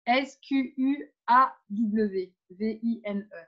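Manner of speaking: letter by letter, evenly spaced; careful, separated French letter names; spelling out. Shown as a demonstration. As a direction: spell the word out for listeners. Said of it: "je vous l'épelle".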